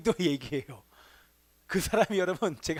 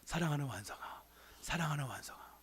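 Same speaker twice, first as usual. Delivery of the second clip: soft, lower, tender calling of the name; 7 semitones lower, 12 dB quieter than usual. Retold or imitated. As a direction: call him gently as a loving father. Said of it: "꼭 그렇게 붙여주십니다". (다정하고 부드럽게 부르는 목소리)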